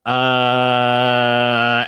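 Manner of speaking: long, level drawn-out hesitation; imitating a teacher at a loss; stumped tutor. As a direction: stall on one held note. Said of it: "and he's like".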